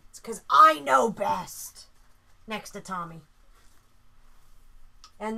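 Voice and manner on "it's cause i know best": loud, drawn-out playful boast; mock boasting. Wife claiming Sammy's choice is best, loud mock boasting. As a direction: belt it out with slow mock authority.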